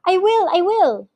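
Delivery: high, urgent assurance; desperate, hasty promise; eager pleading. A narrator's reading. Mister Gregg promises under threat.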